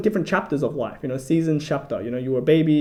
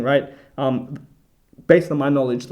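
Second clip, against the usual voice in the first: ordinary talk, nothing marked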